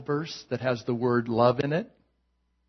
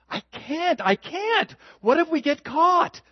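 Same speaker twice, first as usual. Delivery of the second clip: put-on high anxious girl voice. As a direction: fret in a high nervous girl voice. Girlfriend voiced in joke.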